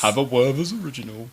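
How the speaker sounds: put-on kindly old grandpa voice; granddad voice. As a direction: offer a sweet like a granddad. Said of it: "That's my granddad voice".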